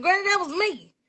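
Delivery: high-pitched, playful insistence; retold insisting. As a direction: act out setting granny straight. Acts out telling granny.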